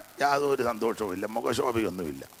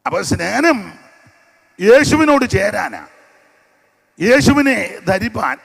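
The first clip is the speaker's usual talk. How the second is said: loud, high, slow proclamation; preaching at full force; 8 semitones higher, 13 dB louder, slower than usual. Acted. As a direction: lift the congregation with it. Preacher raises his voice. (ശക്തമായ പ്രസംഗശൈലി)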